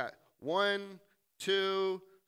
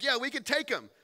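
higher, eager bravado; confident boast; 8 semitones higher, 4 dB louder than usual. Acted. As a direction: pump up the group. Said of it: "Joshua's like".

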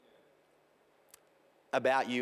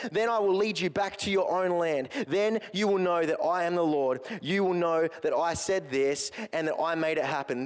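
raised, measured reading of the prophecy; scripture reading; 6 semitones higher than usual. Acted. Preacher reading Ezekiel's vision to the congregation, raised and measured. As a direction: read God's promise aloud to the church.

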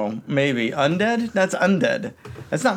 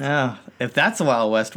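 usual speaking voice

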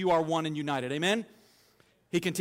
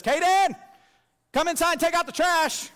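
a much higher, louder shout out the back door; retold yell; 10 semitones higher, 5 dB louder than usual. Little sister calling her brother in from the back door, loud high yell. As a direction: holler for her brother across the yard. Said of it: "yells and says".

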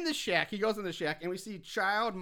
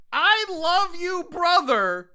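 higher, louder, slow mock tenderness; mock tender; 10 semitones higher, 9 dB louder, much slower than usual. Podcaster voicing Lori to dying brother, mock tender. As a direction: coo it with exaggerated love. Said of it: "and says".

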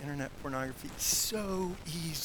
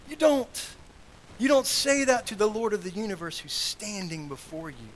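raised, loud burst of reproof; indignant rebuke; 5 semitones higher, 5 dB louder than usual. Real, not acted. Preacher rebuking disrespect to the King of the universe, loud indignation. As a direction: start high and forceful, easing toward the end.

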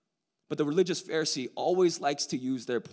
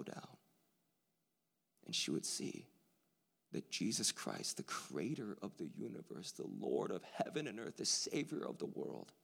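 hushed tender; 8 semitones lower, 9 dB quieter than usual. Acted. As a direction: let it sink in gently, almost whispered. Tender hushed retelling of the climax.

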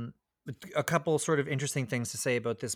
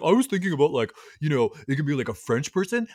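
louder, lightly mocked pitch of bad ideas; eager joke pitcher; 4 semitones higher, 5 dB louder than usual. Comic pitching ideas to his writer, lightly mocked. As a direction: imitate someone pitching half-baked jokes. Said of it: "Woody to write jokes for him".

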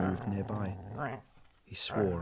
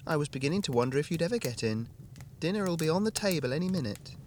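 lightly higher woman's voice, quick; wife voice; 7 semitones higher than usual. A narrator's reading. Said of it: "Hannah's dialogue, higher voice".

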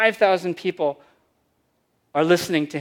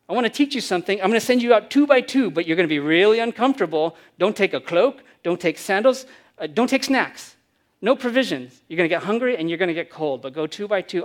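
urgent commands; 3 semitones higher, 6 dB louder than usual. Acted. Jesus's commands acted out.

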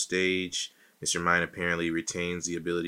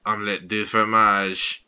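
mock French accent. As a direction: ham up a fake French accent. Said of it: "he's French".

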